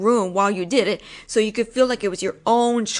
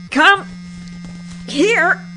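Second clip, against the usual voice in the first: sudden command; 3 semitones higher than usual. Acted. Grabs him suddenly.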